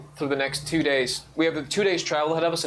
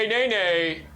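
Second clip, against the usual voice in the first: horse neigh; 6 semitones higher, slower than usual. Acted.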